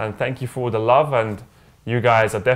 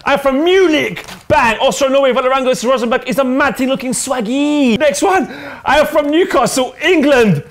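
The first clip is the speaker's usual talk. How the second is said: high, loud, excited roll call; pumped-up high fan shout-outs; hyped shout-outs; 12 semitones higher, 6 dB louder than usual. Acted. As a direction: hype each fan's name up.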